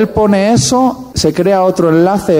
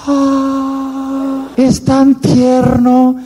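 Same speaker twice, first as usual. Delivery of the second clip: high, slow, melting sigh; much higher, slow dreamy swoon; swooning girlfriend; 8 semitones higher, much slower than usual. Acted. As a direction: swoon over how tender he is. Girlfriend melts at his tear.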